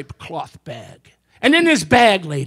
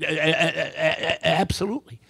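a job seeker stammering out his answer; stuttering; 5 semitones lower, 5 dB quieter than usual. Acted. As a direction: stammer on the A before absolutely. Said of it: "a speech impediment".

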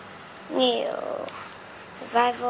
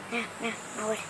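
normal talking voice

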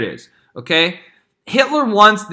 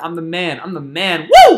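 hammed-up victory whoop after the boast; mock triumph; 7 dB louder than usual. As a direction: crow about it, then cheer. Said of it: "He's like".

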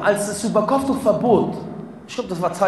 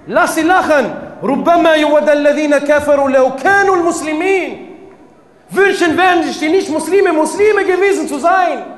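loud, higher, insistent sermon delivery; full-force preaching; 7 semitones higher, 6 dB louder than usual. Acted. Preacher warning the brothers and sisters, at full force. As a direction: hammer it home to the congregation.

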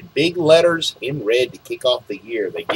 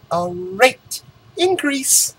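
slow reveal; 8 semitones higher, slower than usual. Shown as a demonstration. Drawn-out reveal.

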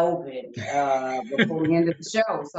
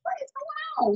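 much higher, thin startled squeak; mock squeaky alarm; 5 dB quieter than usual. Imagined person spotting the lash on her lip, high squeak. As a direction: squeal it in a tiny panicked voice. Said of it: "Be like".

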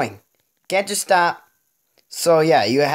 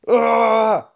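frustrated groan; 4 semitones higher, 3 dB louder than usual. Real, not acted. Frustrated groan after losing.

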